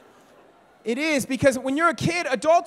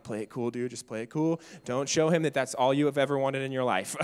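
inner voice; 9 semitones lower than usual. Retold or imitated. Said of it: "And I'm like".

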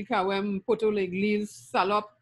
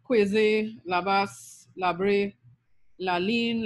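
usual speaking voice

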